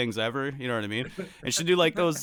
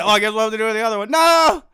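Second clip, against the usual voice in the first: rushed build into a high shout; loud comic wail at the end; mock protest; 7 semitones higher, 8 dB louder, faster than usual. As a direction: climb to a hammed-up yelled no. Acted dread of switching arms.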